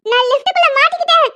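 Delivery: loud, high panicked calls; frightened cries for help; calling for help. Acted. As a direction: cry out from the lift for help. Trapped woman crying for help.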